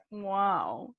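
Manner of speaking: low, deadpan sarcastic wow; sarcastic flat wow. Retold or imitated. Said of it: "It's like".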